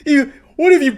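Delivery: high, loud mock-hysterical wailing; hammed-up meltdown. Imitating an angry customer blaming the pizza place, mock meltdown. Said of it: "he starts blaming the pizza place".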